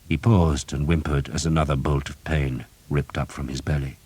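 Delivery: ordinary delivery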